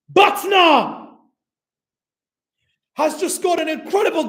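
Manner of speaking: high, loud goal shout; long goal yell. Real, not acted. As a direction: scream the goal call.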